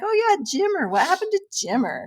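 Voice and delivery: high-pitched retold jolt of remembering; sudden recollection. Host recalling her reaction to the article, surprised. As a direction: start very high, curious and surprised.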